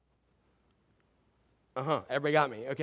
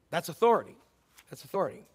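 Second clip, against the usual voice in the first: ordinary talk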